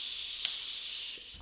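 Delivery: long steady shh out; shushing. Shown as a demonstration. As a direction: shush the air out slowly. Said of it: "this time".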